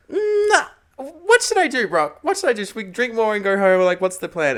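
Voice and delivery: high, loud, giggly pleading to a friend; giddy drunk excitement. Retold or imitated. Tipsy to friend Brock at the table, high giddy pleading. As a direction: squeal it out, tipsy and thrilled. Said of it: "I'm like".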